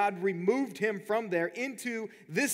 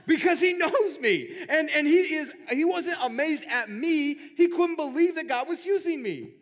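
higher and louder, laughing; excited laughing; 6 semitones higher, 4 dB louder than usual. Real, not acted. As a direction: burst out amazed and laughing. Excitedly tells friend's reaction.

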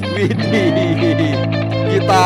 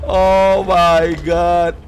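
surprised; 4 dB louder, much slower than usual. Real, not acted. Drawn-out exclamation.

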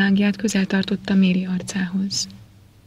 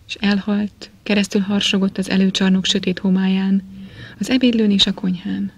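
ordinary delivery

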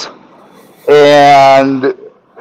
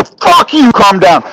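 high, explosive retort, then dropping; retold furious fit; 3 semitones higher than usual. Retelling his retort when told to calm down, angry and high. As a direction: throw the words back in mock fury. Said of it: "I was throwing a fit".

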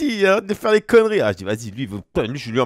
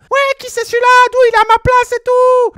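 voix aiguë de Messi; 6 dB louder than usual. Retold or imitated. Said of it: "Parce qu'il a une voix un peu aiguë".

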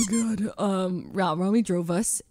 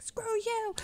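mock yell; 5 dB quieter than usual. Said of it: "So I started yelling at the".

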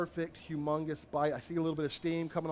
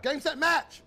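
triumphant; 9 semitones higher, 6 dB louder, slower than usual. Real, not acted. Triumphant catchphrase after big bite.

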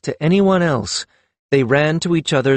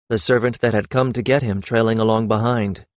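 plain reading voice

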